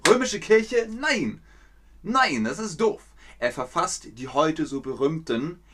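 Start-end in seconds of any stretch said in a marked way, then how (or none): 0.1-3.0 a lightly voiced, higher indignant Luther; mock protest at the Roman church; Luther scolding. Teacher playing Luther, scolding the church, mildly indignant. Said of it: "Er sagt".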